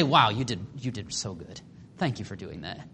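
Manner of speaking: low, soft, deadpan mock thanks; sarcastic praise. Master thanking the servant who wasted everything, quietly sarcastic. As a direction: drop the voice for insincere congratulations. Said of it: "I think he's being sarcastic".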